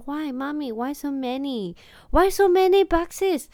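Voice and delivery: put-on high, slow puzzled child's voice; son's voice. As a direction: mimic a little boy questioning mom. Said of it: "亨特就是说". (模仿儿子疑惑地问妈咪)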